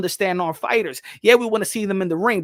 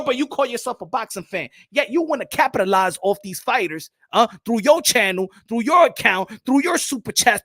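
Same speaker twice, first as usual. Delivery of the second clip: ordinary talk, nothing marked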